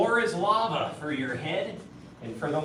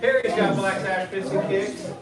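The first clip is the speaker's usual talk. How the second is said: loud, higher cheer; called-out praise; 4 semitones higher, 7 dB louder than usual. Real, not acted. Coach cheers Perry's piston kicks, calling out praise. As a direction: shout encouragement over the class.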